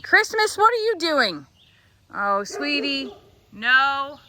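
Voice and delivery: high, loud startled pet talk; high pet scolding. Real, not acted. Farmer catches her animal misbehaving, high pet talk. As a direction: call out to the animal in surprise.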